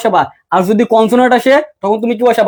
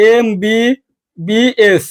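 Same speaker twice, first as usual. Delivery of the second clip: "MBBS" very slowly, each letter stretched and separate; "MBBS" drawn out, one letter at a time; much slower than usual. Shown as a demonstration. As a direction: spell out "MBBS" with gaps between letters. Teacher spells abbreviation letter by letter.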